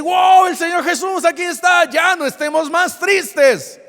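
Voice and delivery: high, loud amazed cheer; overjoyed exclamation. Acted. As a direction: burst out in wonder and joy. Acted joyful reaction to seeing Jesus.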